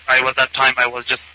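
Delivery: ordinary talk, nothing marked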